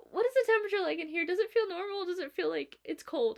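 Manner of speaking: asking the boss if the room is cold; retold feverish question. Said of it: "I'm like".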